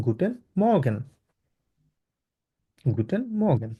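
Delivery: গুটেন মর্গেন said clearly for repeating; practice model. Shown as a demonstration. Teacher leads class practice of গুটেন মর্গেন. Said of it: "practice করেন".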